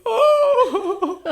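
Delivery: high, loud mock wail of longing; imitated howl. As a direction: wail at the food with laughing sobs. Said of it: "She was just howling at this food".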